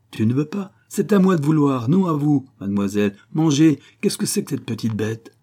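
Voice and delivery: put-on high, loud scolding of a child; angry father. The father scolds his daughter who won't eat, angry and loud. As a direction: snap at the defiant little girl.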